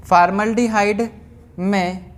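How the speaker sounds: slow, word-by-word dictation with long pauses; classroom dictation. Acted. Teacher reading out notes for students to write, measured dictation. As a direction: dictate in small, evenly stretched chunks.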